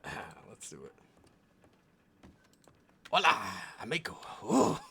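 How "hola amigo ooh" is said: put-on Mexican accent. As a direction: greet him like a cocky Mexican bandit, laughing. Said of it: "I can't do the accent".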